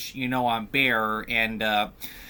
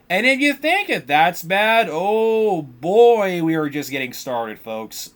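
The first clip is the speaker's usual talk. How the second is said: excited teaser; 3 semitones higher, 6 dB louder than usual. Real, not acted. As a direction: build suspense for the audience. Teasing worse events to viewers.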